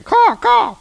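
high loud bird cawing; raven caw. Acted. Ravens calling to the children, loud high caw. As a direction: squawk it out like a raven. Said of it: "they're going".

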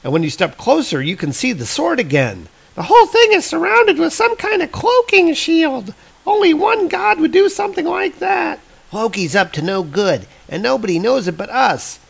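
put-on high girl voice, eager and insistent; urgent young girl. Hildi telling her brothers about Loki's cloak, high urgent girl voice. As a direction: explain it fast like a sharp kid who's sure. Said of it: "And Hildi's like".

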